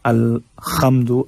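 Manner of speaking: slow अलहम्दु with "kh" for the ह; wrong rasping ha. Shown as a demonstration. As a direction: say अलहम्दु as "al-khamdu". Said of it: "अलहम्दु नहीं पढ़ना है". (अलहम्दु में हा की जगह ख़)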